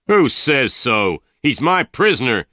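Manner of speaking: angry shout. A narrator's reading. Tull shouts at the stranger in anger. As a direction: shout him down. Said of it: "Tull shouted".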